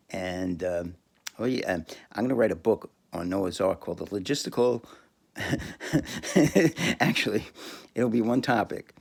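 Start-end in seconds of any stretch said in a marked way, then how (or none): 5.4-7.0 giggling laugh. Real, not acted. Laughing at own book idea.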